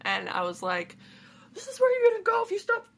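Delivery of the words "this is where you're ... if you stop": high fast mock scold. Acting scolding voice.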